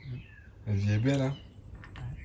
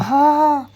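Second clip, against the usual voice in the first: loud groan; 12 dB louder than usual. Real, not acted. Sigh of fullness.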